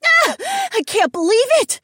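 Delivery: very high, loud burst of delight; excited squeal. A narrator's reading. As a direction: squeal in thrilled disbelief. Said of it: "Mila squealed".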